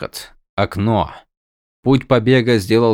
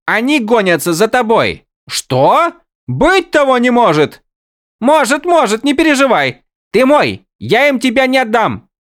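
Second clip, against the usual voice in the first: high excited character voice; 14 semitones higher, 5 dB louder than usual. Acted.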